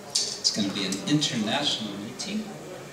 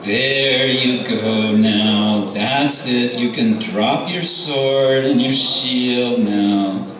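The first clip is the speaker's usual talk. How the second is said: higher, amused and lordly divine voice; a playful god teasing the demon he holds on his lap, pitched up; Nrsingadev's voice; 6 semitones higher, 4 dB louder than usual. Acted.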